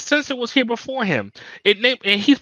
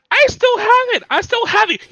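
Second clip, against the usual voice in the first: high, loud mock boast; mocking impression; 12 semitones higher, 5 dB louder than usual. Mocking the washed-up pro's boast, high and loud. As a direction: sneer it in a whiny high voice. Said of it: "you try so hard to be like".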